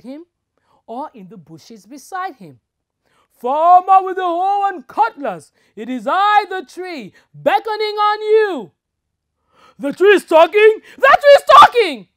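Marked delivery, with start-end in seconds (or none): 3.3-8.7 high, loud mock spirit call; talking tree voice. Tree voice again. 9.8-12.1 shrill, much higher panicked scream; terrified yell. Acted. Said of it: "yelled the young farmer".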